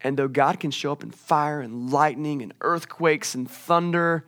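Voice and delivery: ordinary delivery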